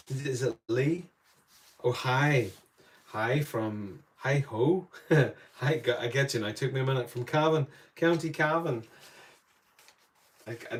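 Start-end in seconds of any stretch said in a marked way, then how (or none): none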